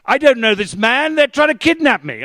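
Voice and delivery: high, loud imitated scream of an old woman; retold scream. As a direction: shriek it out as the frightened granny. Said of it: "she screams suddenly".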